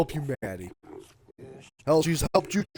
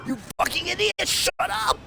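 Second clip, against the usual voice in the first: much higher, "idiot" rising into the yell; furious yell; 14 semitones higher than usual. Real, not acted. Vlogger yells at the politicians, furious. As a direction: lose it completely.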